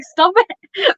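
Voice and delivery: playful yell at the screen; mock protest. At the Shrek soundtrack, playful protesting yell. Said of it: "And I'm like".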